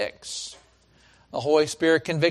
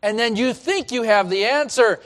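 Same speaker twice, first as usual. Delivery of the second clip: usual speaking voice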